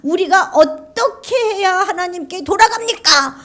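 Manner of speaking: high, loud, tearful retort; defiant talking back. Acted. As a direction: talk back, half crying. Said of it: "이렇게 이게 대드는 거예요".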